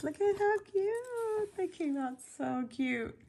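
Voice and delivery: high cutesy gushing; cooing adoration. Real, not acted. Gushing over the Shrinky Dinks.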